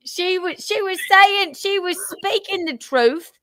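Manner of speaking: hammed-up British accent, pitched high; mock British accent. Host answers the caller in mock British accent. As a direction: talk like a chummy London bloke. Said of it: "I can't say whippet in a British accent".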